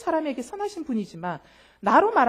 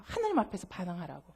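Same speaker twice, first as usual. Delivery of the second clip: usual speaking voice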